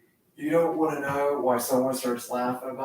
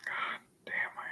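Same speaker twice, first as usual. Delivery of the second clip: whispered curse; 14 dB quieter than usual. Real, not acted. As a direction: breathe the words out in a hush. Hushed curse after loud lines.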